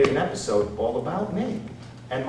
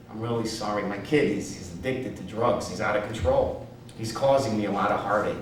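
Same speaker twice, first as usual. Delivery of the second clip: lower, somewhat softer confession; agent's apology; 5 semitones lower, 5 dB quieter than usual. Retold or imitated. Agent confiding to his client about his son, subdued apology. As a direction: quietly own up to a family trouble. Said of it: "Irvin looked up and he goes".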